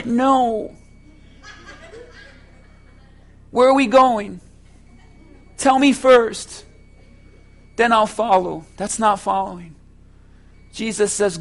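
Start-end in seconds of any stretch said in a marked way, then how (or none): 0.0-9.7 loud, driving pulpit delivery; full-force preaching. Acted. Preacher urging the congregation to follow, fervent. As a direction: preach it out to the whole church.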